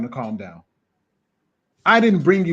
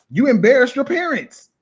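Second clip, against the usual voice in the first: indignant outburst; 9 semitones higher, 5 dB louder than usual. Real, not acted. Exclaiming indignantly.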